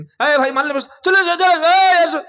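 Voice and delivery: high, loud market-stall shouting; shouting to customers. Retold or imitated. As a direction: yell for buyers to come over. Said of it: "কোনোদিন চিল্লাতে দেখেছেন".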